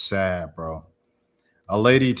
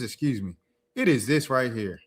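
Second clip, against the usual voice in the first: ordinary talk, nothing marked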